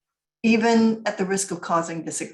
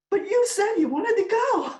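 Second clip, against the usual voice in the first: much higher, stunned protest; shocked; 13 semitones higher than usual. Retold or imitated. Family shocked at grandma's confession, high and stunned. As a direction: gasp it out in disbelief. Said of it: "They're all shocked".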